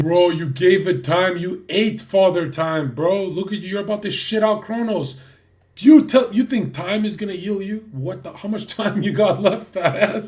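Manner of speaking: shouting, high and loud; loud mocking rant. Real, not acted. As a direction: yell it at him in ridicule. Mocking outburst at S1.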